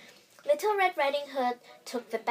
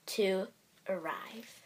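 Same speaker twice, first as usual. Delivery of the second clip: hushed whisper; 3 semitones lower, 7 dB quieter, slower than usual. A narrator's reading. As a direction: breathe it out in a whisper. Narrator whispers ending line.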